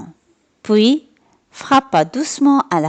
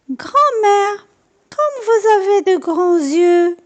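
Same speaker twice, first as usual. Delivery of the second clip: put-on much higher, amazed little girl; wondering girl; 10 semitones higher, slower than usual. A narrator's reading. Girl staring at the wolf in bed, amazed. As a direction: marvel at her big ears. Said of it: "dit encore Petit Chaperon".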